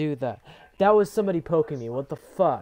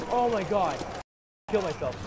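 ordinary talk, nothing marked

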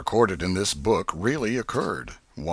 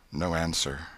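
ordinary delivery, nothing marked

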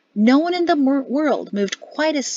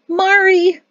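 high, loud delighted call; joyful greeting; 10 semitones higher, 7 dB louder than usual. A narrator's reading. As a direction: call out to your daughter-in-law in delight. Mother-in-law joyfully greets Mari.

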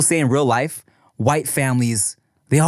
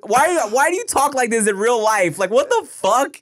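incredulous laughing; 8 semitones higher than usual. Retold or imitated. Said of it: "And I was like".

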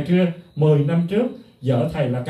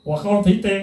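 usual speaking voice

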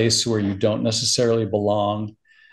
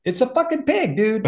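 high, squeaky kid voice mocking the movie; mock bratty kid; 12 semitones higher than usual. Father imitating his daughter's scorn, high and squeaky. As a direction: play a scornful nine-year-old, pitched way up. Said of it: "And she's like".

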